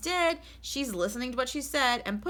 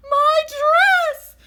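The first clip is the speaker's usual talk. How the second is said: shrieked much higher and louder; exclaimed; 17 dB louder than usual. Acted. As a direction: cry out in horror at the ruined dress. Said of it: "exclaimed Missus Rogers".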